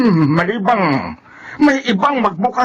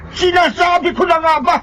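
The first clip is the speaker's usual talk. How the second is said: raised, agitated cry; alarmed shout; 7 semitones higher than usual. Acted. Brother crying out in dismay, agitated.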